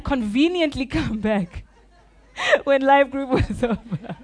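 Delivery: laughing through the words at the memory. Real, not acted. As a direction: giggle it out. Laughing at own story.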